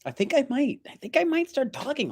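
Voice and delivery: a tiny giggly mouse voice; squeaky voice. Pretend. Squeaky aside after accent bit.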